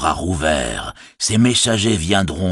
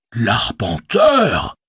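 slow, drawn-out amazed L'arpenteur; stunned, the penny drops; amazed exclamation; 4 semitones higher, much slower than usual. Acted. Innkeeper realising who Strider is, slow amazed cry. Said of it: "s'exclama-t-il".

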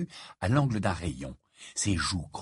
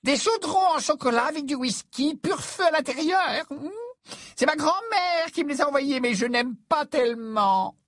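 put-on very high, simpering girlish voice; cutesy high-pitched admirer; Romilda Vane. A narrator's reading. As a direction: gush squeakily over the gift. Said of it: "dit Romilda".

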